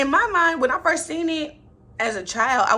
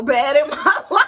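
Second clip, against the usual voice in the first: laughing through a crush confession; giddy laughing admission; 6 semitones higher, 9 dB louder than usual. Real, not acted. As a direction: giggle out the words.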